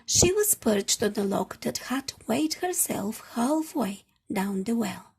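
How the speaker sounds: ordinary delivery, nothing marked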